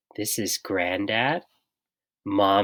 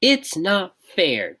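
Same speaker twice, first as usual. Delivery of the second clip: whiny complaint; 7 semitones higher, slower than usual. A narrator's reading. George complains.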